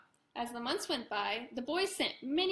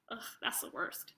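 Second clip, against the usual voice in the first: muttered groan; 3 semitones lower, 8 dB quieter than usual. Acted. Aside, groan.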